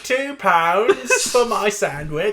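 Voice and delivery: high, slow, hammed-up British; overdone British kid, loud and drawn out; British accent. Showing a friend a silly British accent. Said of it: "I can do British".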